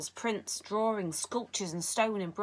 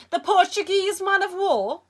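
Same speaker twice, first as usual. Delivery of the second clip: put-on a high, loud Egyptian-accented Sale; lightly done Cairo marketplace accent, proud; heavy Cairo accent; 11 semitones higher, 9 dB louder than usual. A narrator's reading. Villain Sale shows the boy his jellyfish, Cairo accent. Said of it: "He had a heavy accent brought with him from the Cairo marketplace".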